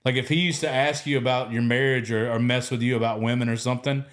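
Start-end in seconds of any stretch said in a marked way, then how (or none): none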